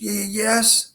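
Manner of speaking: stammering fear. A narrator's reading. Frightened bear giant stammers.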